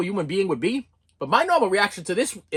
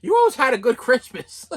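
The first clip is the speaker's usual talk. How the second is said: laughing bitterly; 4 semitones higher than usual. Real, not acted. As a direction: repeat it through a laugh. Bitter laughing repeat of mother.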